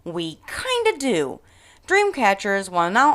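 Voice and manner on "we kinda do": high, sassy comeback; sassy retort. Pretend. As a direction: set Karen straight with a smirk. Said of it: "all I can say is".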